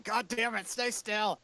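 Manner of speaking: high-pitched exasperated mock scream. Player as character chasing zombies round the block, mock screaming.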